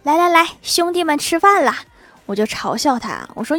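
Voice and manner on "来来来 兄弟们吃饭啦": 喊乌龟吃饭的高声呼唤; calling out. Retold or imitated. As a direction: holler as if calling the family to dinner. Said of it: "喂食的时候总是喊".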